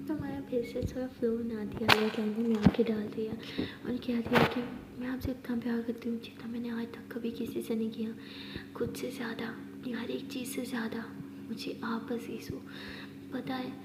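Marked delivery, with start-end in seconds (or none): 5.0-12.6 hushed, heartfelt whisper into her eyes; tender whisper. Acted. Maya confesses love to Aliya, whispering tenderly. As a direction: breathe each word softly, close to her.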